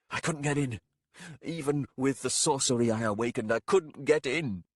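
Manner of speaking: strained warlock. A narrator's reading. Warlock voice continues.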